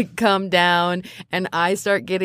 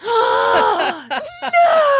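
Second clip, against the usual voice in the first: shocked gasp. Acted. Bunny girl hearing her crush revealed, shrill gasp. Player's character outed about crush.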